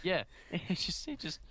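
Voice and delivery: laughing. Real, not acted. Laughing at the rap line.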